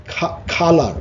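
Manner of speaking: usual speaking voice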